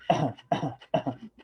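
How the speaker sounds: a couple of forced coughs, for show; demo cough. Shown as a demonstration. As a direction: cough it out so the class hears. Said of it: "This is cough".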